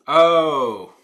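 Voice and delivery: loud high oh. Acted. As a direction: blurt it out loud. Loud exclamation.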